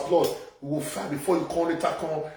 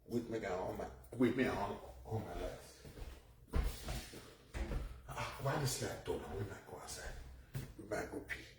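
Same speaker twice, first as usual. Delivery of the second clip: muttered much softer, half off-mic; a soft aside while getting up; mumbled aside; 9 semitones lower, 19 dB quieter than usual. Real, not acted. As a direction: mumble it to yourself. Muttering aside, stepping away.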